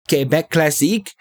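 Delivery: Quebec Classique said as kay-bek klah-SEEK; French pronunciation. Retold or imitated. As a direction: Classique in a mock French accent. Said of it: "to look at him and say".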